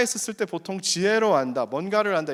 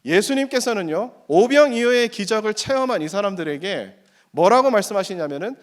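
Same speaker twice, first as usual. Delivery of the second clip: ordinary delivery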